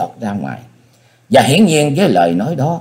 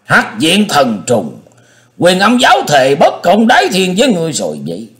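thundering shout; 7 semitones higher, 3 dB louder than usual. Acted. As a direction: roar the oath out loud. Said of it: "hét lừng lững". (hét vang lừng lững)

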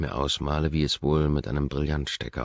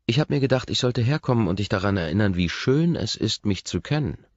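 loud, higher, teasing challenge; challenging; 7 semitones higher, 5 dB louder than usual. A narrator's reading. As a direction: throw the words down as a dare. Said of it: "Die Herausforderung in meinem Tonfall ist unüberhörbar".

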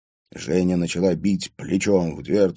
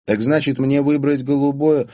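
higher, delighted question; 7 semitones higher, 4 dB louder than usual. A narrator's reading. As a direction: perk up happily at the idea. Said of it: "обрадовалась". (обрадованно)

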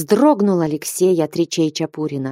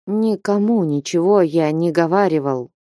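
ordinary delivery

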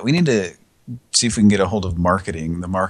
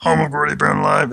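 higher, louder announcer voice; announcer plug; 6 semitones higher, 5 dB louder than usual. Acted. Host plugging tomorrow's show, announcer style. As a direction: boom it out like a radio promo.

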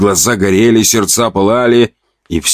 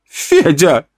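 high startled cry; 9 semitones higher than usual. Acted. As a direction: gasp out the name in shock. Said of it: "вскрикнула та".

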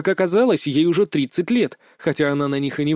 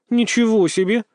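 genuinely amazed, higher and brighter; surprised; 6 semitones higher, 3 dB louder than usual. Acted. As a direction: caught off guard, impressed. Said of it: "неподдельно удивилась Света".

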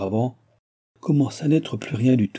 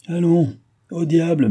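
raised, exasperated voice; impatient dismissal; 8 semitones higher, 5 dB louder than usual. A narrator's reading. Baron hurrying his daughter to the coach, impatient dismissal. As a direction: wave it away, high and loud.

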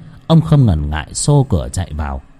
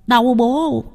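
put-on yelping boy; 12 semitones higher than usual. Boy yelps after a slap.

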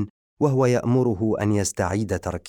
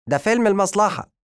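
1 shrill indignant exclamation; 13 semitones higher, 5 dB louder, faster than usual. Acted.

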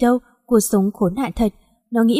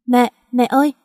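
calling out; 3 semitones higher than usual. Acted. Chasing mother in dream.

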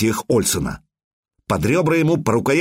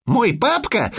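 put-on boy proclaiming; 11 semitones higher, slower than usual. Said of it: "неожиданно провещал Эверетт".

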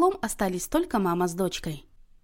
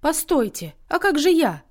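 higher, louder plaintive protest; crying out; 7 semitones higher, 4 dB louder than usual. A narrator's reading. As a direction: call out so as not to be left out. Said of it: "вскричала Сонечка". (вскрикивая, высоко и громко)

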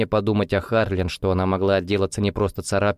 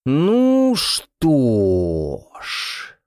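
very slow, stretched-out drawl; drawn out; 5 semitones higher, 3 dB louder, much slower than usual. A narrator's reading. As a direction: stretch the words out long. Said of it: "протягивает Адам".

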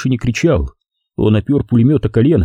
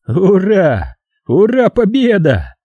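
high, slow sneering hurrah; mocking imitation; 9 semitones higher, slower than usual. A narrator's reading. Veteran mocking the Russian shout, high sneering. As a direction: mimic the enemy's cry with scorn. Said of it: "передразнил русских ветеран".

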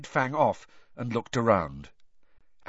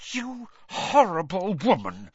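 higher, tense, contained fury; through gritted teeth; 8 semitones higher than usual. A narrator's reading. Hermione standing up to the reporter, through gritted teeth. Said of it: "through gritted teeth".

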